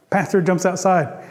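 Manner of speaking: usual speaking voice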